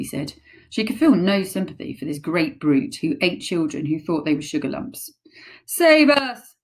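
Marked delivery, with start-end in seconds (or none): 5.7-6.6 loud high terrified scream. Acted. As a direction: scream for rescue. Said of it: "screamed the flesh lump eater".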